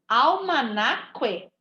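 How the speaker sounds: Almanaque ending in -kwe, the U sounded; deliberately wrong. Shown as a demonstration. Teacher showing pupils the wrong way with the U sounded. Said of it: "Se fosse pra eu ouvir o som do U".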